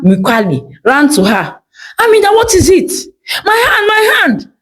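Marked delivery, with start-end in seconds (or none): 2.0-2.9 much higher, urgent and worried; alarmed neighbor. Acted. Neighbor runs to screaming Amina. 3.4-4.5 much higher, tearful cry; crying in pain. Acted. Amina in pain after screaming.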